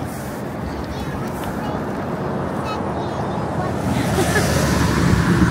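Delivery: airy unvoiced laughter; breathy laugh. Real, not acted. Mom laughing at her child's answer, breathy laugh.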